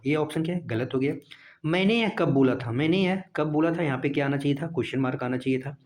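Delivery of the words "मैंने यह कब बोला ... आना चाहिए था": normal talking voice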